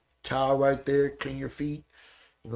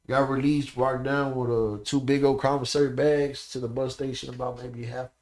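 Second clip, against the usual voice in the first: ordinary delivery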